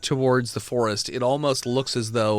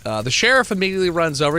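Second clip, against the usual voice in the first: ordinary delivery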